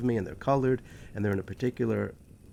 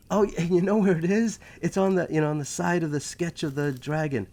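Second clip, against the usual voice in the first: higher-pitched burst of realisation; excited recall; 6 semitones higher, 3 dB louder than usual. Retold or imitated. Student finding the answer in remembered notes, excited. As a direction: act out suddenly spotting the answer.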